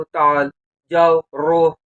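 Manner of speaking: ताल drawn out slowly; teaching the Arabic for come, slowly; slow word demo. Shown as a demonstration. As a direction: say ताल slowly so learners catch it. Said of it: "बोलते हैं ताल".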